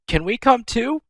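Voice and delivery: high small begging voice; eager little sisters. Retold or imitated. Little sisters asking to come, high childish plea. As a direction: ask up high like a little kid. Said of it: "little sisters are like".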